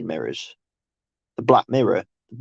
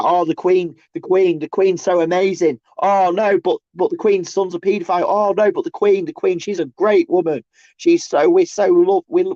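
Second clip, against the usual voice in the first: high, sneering mimic of gushing royal fans; mocking the masses; 7 semitones higher than usual. Ranting at his stream, mimicking royal fans in a high mocking voice. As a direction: send up the flag-wavers in a silly high voice. Mocking flag-waving Queen lovers.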